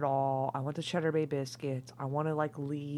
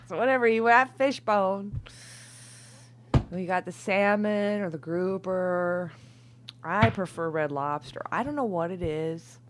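suddenly much louder and higher; loud outburst; 4 semitones higher, 12 dB louder than usual. Real, not acted. Tipsy reader shouting her restaurant preference, loud.

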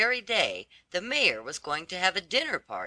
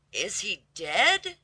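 slow, higher-pitched exclaimed question; exclaiming; 5 semitones higher, slower than usual. A narrator's reading. Laundress questioning Martha about the death, exclaiming. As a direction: ask it slowly in rising disbelief. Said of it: "she exclaimed".

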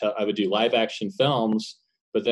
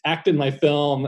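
mildly pleading, higher pitch; retold begging; 6 semitones higher, 4 dB louder, slower than usual. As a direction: imitate a drawn-out plea to friends. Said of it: "basically begging my friends".

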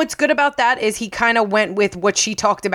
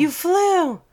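mock awe; 9 semitones higher than usual. Echoes the acted awed quote.

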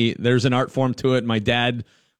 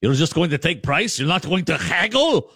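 put-on puzzled old-timer; 5 semitones higher than usual. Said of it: "He's like".

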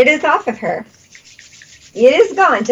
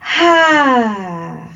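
healer's breathing coaching; 2 semitones higher than usual. Acted. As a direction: coach each breath, then settle.

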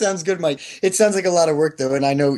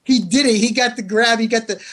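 ordinary talk, nothing marked

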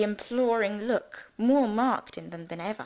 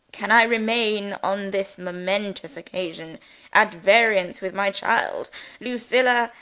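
pompous rector; 4 dB louder than usual. Acted. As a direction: preach at them like a self-important parson. Pompous rector addresses family.

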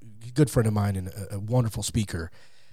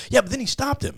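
high-pitched imitation of an objector; mock objection; 12 semitones higher, faster than usual. Christians objecting to the Abraham story, in a high mock protest. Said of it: "that's what the Christians go".

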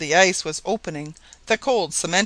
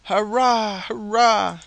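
higher, drawn-out narrated hurrah; mild cheering shout; 4 semitones higher, slower than usual. A narrator's reading. Colonists cheering as the ice refreezes, mildly shouted hurrahs. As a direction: cheer it out with restraint. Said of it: "shouted all with one voice".

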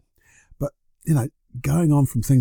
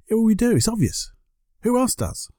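much higher, insistent; emphatic retort; 11 semitones higher than usual. Real, not acted. Answering the doubting listener with high emphatic certainty. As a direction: shoot back as if it's obvious.

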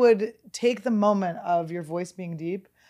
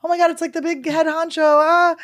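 fast, higher mock panic ending in a yelp; hammed-up fake fear with a little yelp; mock scared; 9 semitones higher, 6 dB louder than usual. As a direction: play-act being frightened of the boss. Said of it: "I pretend to be scared of him".

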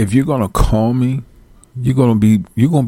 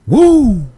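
high whoop; 5 dB louder than usual. Retold or imitated. Reacting to the caller's eagerness.

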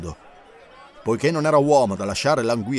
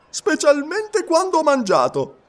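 much higher, slowed for the punchline; cheeky quip; 14 semitones higher, 4 dB louder, slower than usual. A narrator's reading. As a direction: deliver it as a joke. Passepartout's joke.

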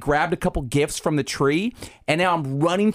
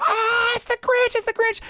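put-on high squealing kid voice; mock terrified child shriek; imitating scared little kids yelling; kids screaming. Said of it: "they both scream".

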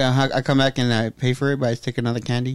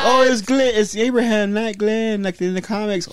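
mock know-it-all fan; 8 semitones higher than usual. Mocking fans who quote the comics, smug higher voice. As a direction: sneer it like a comic-book fan correcting everyone. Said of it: "everyone's like".

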